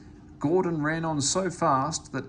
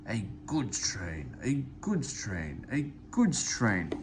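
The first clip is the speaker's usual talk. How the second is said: grumbling; 2 semitones lower, 3 dB quieter, slower than usual. A narrator's reading. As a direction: mutter it in disgust, slowly. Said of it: "he grumbled".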